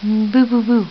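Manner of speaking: low, loud playful boos; peekaboo, deep and loud. Real, not acted. Playing peekaboo with baby.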